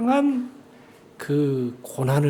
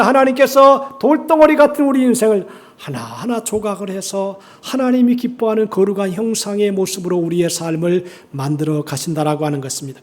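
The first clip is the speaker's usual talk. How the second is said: ordinary talk, nothing marked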